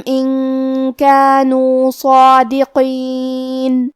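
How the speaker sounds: slow melodic tajweed chant; measured Quranic recitation. Shown as a demonstration. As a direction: recite the verses slowly with long held vowels. Recites the verses.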